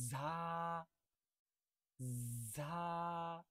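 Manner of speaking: ঝা sustained and clear; jha demo. Shown as a demonstration. Teacher modelling ঝা for home practice. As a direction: model ঝা for students to repeat. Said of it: "চেষ্টা করেন তো".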